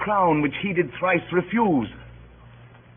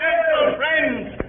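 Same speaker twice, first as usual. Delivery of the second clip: calling out; 13 semitones higher, much slower than usual. Acted. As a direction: rise and summon the whole crowd. Calls out to the crowd.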